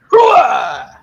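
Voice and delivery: loud gung-ho soldier bark; Al Pacino shout. Retold or imitated. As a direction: yell it like a proud army colonel. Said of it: "you're gonna be Al Pacino from Scent of a Woman".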